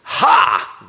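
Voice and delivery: sudden loud demonic "Ha!"; demon shout. Acted. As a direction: bark it out like a demon. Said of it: "I don't know if I can read this quite as well as Pastor Bill did".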